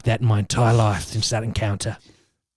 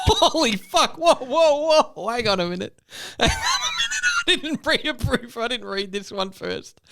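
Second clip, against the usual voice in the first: high, squeaky words through helpless laughter; shocked laughter; 14 semitones higher, slower than usual. Real, not acted. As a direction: protest while cracking up, voice pitched way up.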